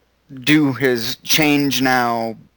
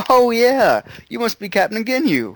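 Gohan voice; 7 semitones higher than usual. Retold or imitated. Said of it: "And Gohan's like".

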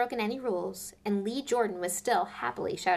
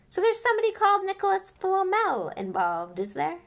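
high, loud eager challenge; Harry pressing Hagrid; eager gotcha; 12 semitones higher, 6 dB louder than usual. Acted. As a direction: press him with glee.

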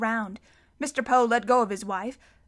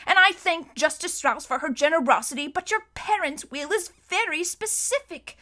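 higher, stiff official regret; apologetic banker; 5 semitones higher than usual. A narrator's reading. Mister Poe telling the children they must leave. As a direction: break bad news politely but firmly.

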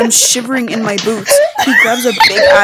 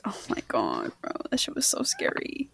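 hushed, breathless whisper; 17 dB quieter than usual. Real, not acted. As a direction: whisper it, still shaken. Reimagining the scary story.

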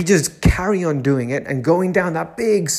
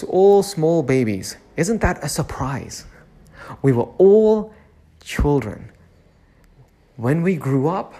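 ordinary talk, nothing marked

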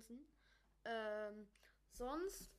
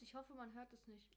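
ordinary talk

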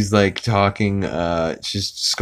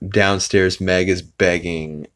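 ordinary talk

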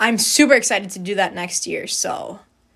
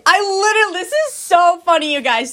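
excited giggly; 9 semitones higher, 5 dB louder than usual. Real, not acted. Excited about a funny story.

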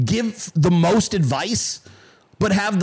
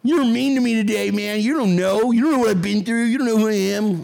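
mock-hurt whine, higher pitched; whiny complaining emailer; 4 semitones higher than usual. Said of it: "chat emailing me just the dumbest fucking".

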